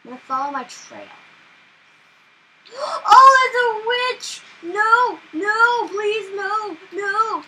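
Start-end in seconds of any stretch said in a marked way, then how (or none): 3.1-7.4 real terrified shout, pleading; panicked scream. Real, not acted. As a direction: scream in panic at the witch, then beg. Panics seeing a witch in game.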